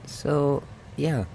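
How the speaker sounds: ordinary talk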